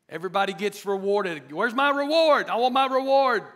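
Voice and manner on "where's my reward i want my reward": high, loud mock demand; demanding shopper. Imitating people demanding rewards in stores, loud and high. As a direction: mimic someone loudly insisting on a prize. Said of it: "I've heard it before in stores".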